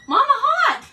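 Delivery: very high, loud playful holler; comic outburst. Real, not acted. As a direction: shriek it in hammed-up protest.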